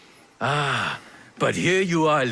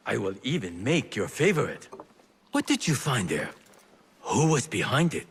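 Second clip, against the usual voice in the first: usual speaking voice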